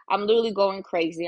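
ordinary talk

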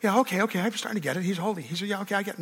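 a quiet, offhand "Yeah, okay, okay" as if only half convinced; an unimpressed listener brushing it off, muttered; softly mocking. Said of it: "like you think".

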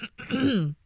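small throat-clearing "ahem"; nervous throat clearing. A narrator's reading. Nervous mouse before her audience, small throat clearing. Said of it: "and cleared her throat".

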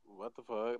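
soft, deadpan bafflement; dry disbelief. Retold or imitated. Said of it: "like, so dry".

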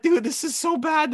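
high, excited disbelief; mock disbelief. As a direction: squeal it in mock horror. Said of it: "I was like".

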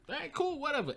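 high, breezy brush-off; dismissive. Retold or imitated. Man brushing off the woman, airy and dismissive. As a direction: toss it off like you don't care. Mimicking his own dismissive reply.

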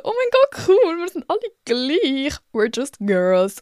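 high-pitched squeal, stretched out at the end; hammed-up, high girly excitement; mock girly gushing. Host celebrating being like all the other girls, squealing mock gush. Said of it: "Aber jetzt bin ich auch so".

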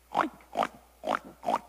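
short, very low, snorted oinks; pig oinks. A narrator's reading. Pig answers the kitten with low, snorting oinks. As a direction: grunt out loud little oinks like a pig. Reader voices the pig's oinks.

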